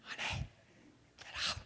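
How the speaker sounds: hushed, careful wake-up; husband gently waking sleeping wife; whisper. Retold or imitated. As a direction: whisper so as not to startle her.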